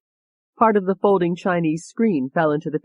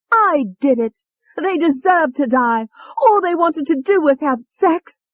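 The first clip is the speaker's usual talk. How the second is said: Tony's furious rant; 11 semitones higher, 6 dB louder than usual. A narrator's reading. Tony raging at David for blaming Alette, furious. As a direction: rage at him like a cornered cockney. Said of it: "Tony said".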